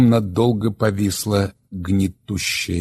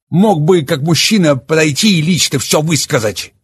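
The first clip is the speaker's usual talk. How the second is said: raised, much higher and louder angry voice; Oleg, bitter and enraged; 8 semitones higher, 8 dB louder than usual. Acted. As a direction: hurl the confession at them defiantly. Oleg rounds on Mai.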